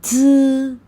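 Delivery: ज buzzed through closed teeth; pinyin z sound. Shown as a demonstration. Teacher showing pinyin z, buzzed ज. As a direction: buzz ज teeth shut. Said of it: "जाल का sound ज".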